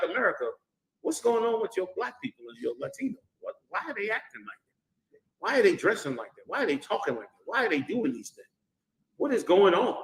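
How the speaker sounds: hushed, baffled questioning by foreign leaders; mock bewildered rulers. Preacher imitating world rulers questioning America, hushed bafflement. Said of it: "they're gonna be like".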